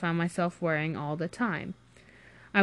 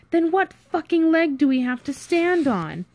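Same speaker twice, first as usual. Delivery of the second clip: high-pitched, heated demand; exasperated challenge; 9 semitones higher, 4 dB louder than usual. Acted. Friend challenges the cover story, exasperated. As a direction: argue back with raised pitch. Quarrel over the plan.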